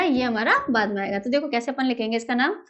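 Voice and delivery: ordinary talk, nothing marked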